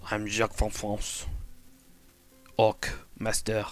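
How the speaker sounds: hammed-up French orc warning; French orc threat. French orc Jacques threatens the viewers, hammed-up. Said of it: "a French accent with an orc voice".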